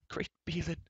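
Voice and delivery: soft, high-pitched muttered oath. A narrator's reading. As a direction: mutter it under your breath, high. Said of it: "muttered the bard".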